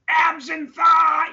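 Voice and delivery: very high, drawn-out holler into a microphone; top-of-lungs scream. Retold or imitated. Announcer yelling over a deafening crowd, screamed at the top of his lungs. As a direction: scream it slowly at full lung power. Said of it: "scream at the top of his lungs".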